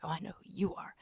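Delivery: half-whispered imitation of a stalker; hushed menace. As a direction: murmur it softly, as the man who knows. Voices kidnapper recognizing her.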